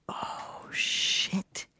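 hushed, voiceless shock; barely audible, dumbstruck swearing; stunned whisper. Retold or imitated. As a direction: whisper it as the realization lands. Stunned reaction after the revelation.

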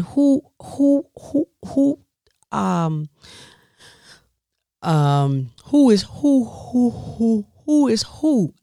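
high, stammering panic over who is who; flustered stammering. Retold or imitated. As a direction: stumble over the words in alarm. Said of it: "I said".

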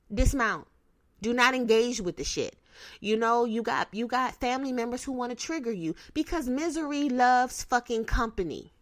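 normal talking voice

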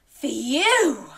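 loud, high, swooping phew; relieved phew. Pretend. Storyteller relieved the wolf has gone, exaggerated phew. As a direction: blow out big pretend relief.

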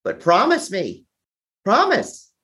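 high, urgent pleading voice; pleading mother. Retold or imitated. Ailing mother begging her son to promise, high urgent plea. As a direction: beg him to promise, rising on the last word.

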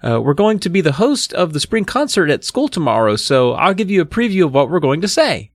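put-on high girlish character voice; storybook girl giving a preview; girl presenting dummy. A narrator's reading. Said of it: "Chris took her place on the chair".

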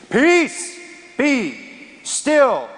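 high, loud shout over the storm; commanding shout. Acted. Jesus shouting down the storm, a loud command. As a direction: bellow it at the wind and waves. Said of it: "he shouted at those winds and waves".

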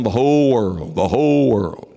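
"whole world" leaned on and repeated; stress on "whole world". Retold or imitated. Preacher imitating other preachers' emphasis, stressing "whole world". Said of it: "their emphasis is on".

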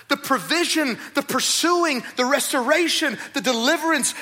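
loud, high, insistent appeal; Jesus pleading. Acted. Jesus speaking to us from heaven, loud and insistent. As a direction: plead with them to remember.

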